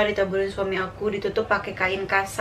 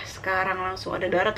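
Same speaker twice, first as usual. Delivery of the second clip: normal talking voice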